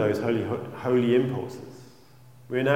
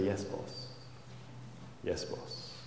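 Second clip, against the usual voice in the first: fond yes boss; 4 semitones lower, 16 dB quieter, much slower than usual. Shown as a demonstration. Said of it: "you've got to do it with a smile".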